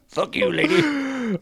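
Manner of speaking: put-on very high, squeaky kid taunt; bratty kid. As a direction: squeal it mockingly at the lady. Redhead kid taunts blind lady.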